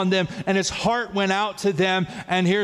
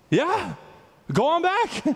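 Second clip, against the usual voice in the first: cheerful priest; 11 semitones higher than usual. Retold or imitated. Priest sends him home.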